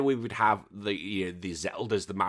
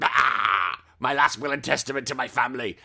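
mock dying. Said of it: "I was like".

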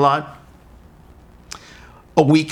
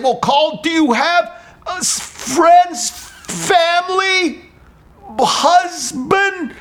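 slow; 14 semitones higher, much slower than usual. Retold or imitated. Speaking slowly to foreigner.

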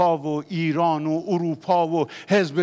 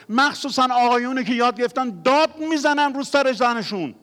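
raised, higher, louder preaching voice; fiery preaching; 10 semitones higher, 6 dB louder than usual. Acted. Pastor urging husbands to stop shouting, fiery preaching. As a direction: preach hard at the men, pleading and urgent.

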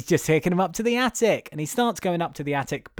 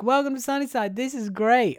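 much higher sing-song greeting; cheery welcome; 9 semitones higher than usual. Retold or imitated. Host voices the Sunnyside toys welcoming, high and chirpy. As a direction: greet them high like a too-eager host. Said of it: "all the toys are like".

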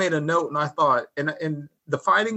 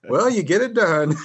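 slow, louder, laughing punchline; amused mock concession; 3 semitones higher, 6 dB louder than usual. Retelling the Federation visitor's reaction, amused drawl. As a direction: draw out the retold quip through a laugh.

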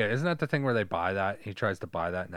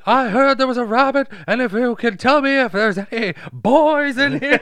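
much higher, louder cartoon villain; 12 dB louder than usual. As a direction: squeal it out like a mock villain. Quoting Judge Doom in movie.

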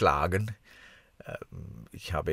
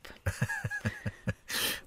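laughing; 6 dB quieter than usual. Real, not acted. Laughs at a joke about holidays.

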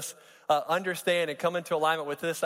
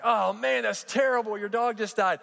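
mock sympathy; 5 semitones higher than usual. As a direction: gasp along in hammed-up sympathy. Joke friend reacting to bad news.

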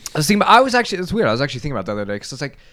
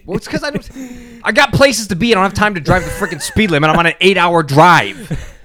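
worked up; 8 semitones higher, 4 dB louder than usual. Real, not acted. Defending his speeding, worked up.

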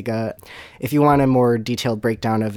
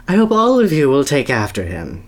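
wistful mother; 3 semitones higher, 6 dB louder than usual. Acted. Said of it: "she said wistfully".

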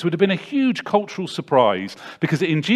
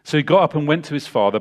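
ordinary talk

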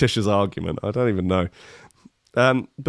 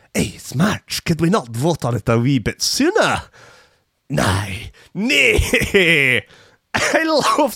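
hammed-up bad Scottish accent, jumping higher; terrible Scottish accent; 4 semitones higher, slower than usual. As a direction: do a deliberately awful Scot. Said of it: "this is the worst Scottish accent ever".

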